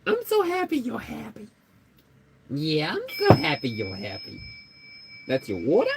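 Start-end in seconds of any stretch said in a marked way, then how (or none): 0.1-1.5 put-on very high cooing baby voice; baby talk to pet. Cooing to her happy pet, very high. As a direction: coo it to the pet. Baby voice then plain repeat.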